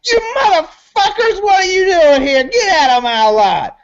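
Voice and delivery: high screechy yell, coming down at the end; frantic high-pitched ranting; yelling crackhead. Acted. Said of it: "he's kinda yelling".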